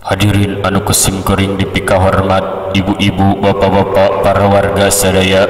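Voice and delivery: measured formal address, phrase by phrase; stately, steady salutation to the audience; clear, dignified delivery. Shown as a demonstration. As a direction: keep it level and evenly spaced. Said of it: "ngadengeun nanti jelas".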